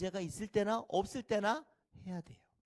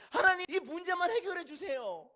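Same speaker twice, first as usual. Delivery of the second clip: high-pitched mock pleading; desperate prayer; 9 semitones higher, 4 dB louder than usual. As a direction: bargain with God, begging hard. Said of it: "문제가 닥쳤을 때". (다급하게 매달리는 기도)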